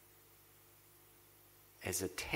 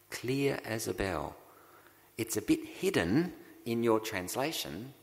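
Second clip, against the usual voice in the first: ordinary delivery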